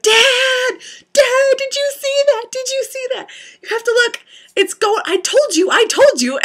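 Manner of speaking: very high, loud, breathless calling to dad; frantic alien alarm. Retold or imitated. Daughter yelling at dad to see the alien, frantic and high. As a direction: shriek it like you just saw a UFO. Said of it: "I was like".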